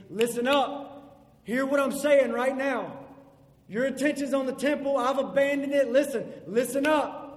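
loud, insistent call to attention; Jesus telling disciples to listen, loud and urgent; urgent command. Acted. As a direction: grab them by the collar with it. Jesus voiced demanding attention.

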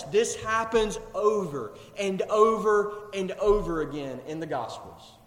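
ordinary talk, nothing marked

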